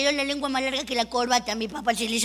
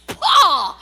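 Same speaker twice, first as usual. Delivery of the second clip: disgusted cry; 8 dB louder than usual. Acted. Reacting to a foul stench.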